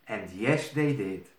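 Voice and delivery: usual speaking voice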